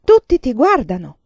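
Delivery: sharp scolding. A narrator's reading. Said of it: "la sgridò Ciccia". (rimprovero secco, più forte)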